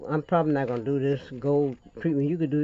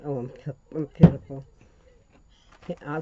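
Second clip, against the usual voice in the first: ordinary talk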